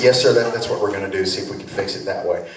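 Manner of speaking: low, fast Southern mechanic; quick Southern drawl, trailing off; Southern service man brushing it off. Retold or imitated. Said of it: "slipped into a little Southern drawl".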